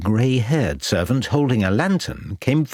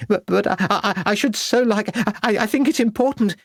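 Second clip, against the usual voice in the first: high, halting, nervous stammer; stammering with concern; 11 semitones higher than usual. A narrator's reading. Wife pushed aside by her husband, stammering anxiously in a high voice. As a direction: trip over the words, climbing higher. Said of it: "her voice full of concern".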